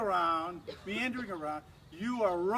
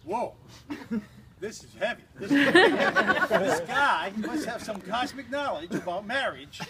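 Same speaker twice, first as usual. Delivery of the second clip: awed whoa; 3 semitones higher, 9 dB louder than usual. Retold or imitated. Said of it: "I said".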